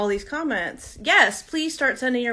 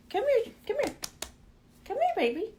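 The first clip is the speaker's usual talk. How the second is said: pet talk; 12 semitones higher, 4 dB quieter, faster than usual. Real, not acted. Coaxing her dog.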